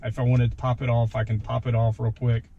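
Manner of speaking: ordinary talk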